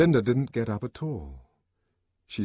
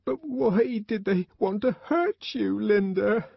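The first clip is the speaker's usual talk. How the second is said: put-on high, slow child's question; tearful child; 11 semitones higher than usual. A narrator's reading. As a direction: ask as a hurt, crying little boy. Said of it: "He was crying".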